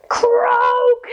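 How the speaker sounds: high, long croak; a playful croak tossed up high; frog croak. Pretend. Reader leaping like a frog with kids, high croak.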